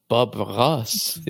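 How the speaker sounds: slow, low French accent; mock-fancy French pronunciation. Shown as a demonstration. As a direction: draw it out, all French-like. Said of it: "when you say it all French-like".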